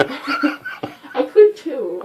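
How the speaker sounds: laughing through it, slow. Real, not acted. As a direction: giggle it out. Laughing protest.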